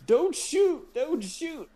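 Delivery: high squeaky mock panic; mock panicked plea. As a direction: beg like a scared clerk, hammed up. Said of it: "dude behind the counter was like".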